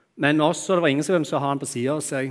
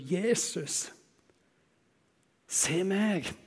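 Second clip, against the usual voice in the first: high, softer, strained plea of the name; pleading cry; 6 semitones higher, 7 dB quieter, much slower than usual. Acted. Blind beggar refusing to give up, softer high plea. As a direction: cry out again, pleading to be seen. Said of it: "Og han roper".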